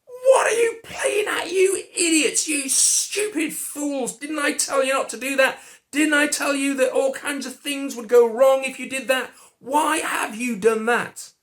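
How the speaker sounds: high, loud angry shouting at the idiots; a preacher voicing his own hypothetical rage at Adam and Eve; furious telling-off. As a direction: storm in and yell at them for disobeying. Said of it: "I might have gone storming into the garden saying".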